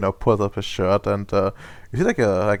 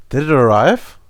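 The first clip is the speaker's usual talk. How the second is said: louder, higher, rising question; eager excitement; 5 semitones higher, 9 dB louder than usual. Acted. Maxwell asks boss about the delivery, eagerly excited. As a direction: burst out hopefully, like it's Christmas. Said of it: "it's Christmas for this guy".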